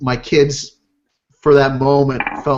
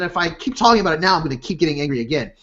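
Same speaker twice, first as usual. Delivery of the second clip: normal talking voice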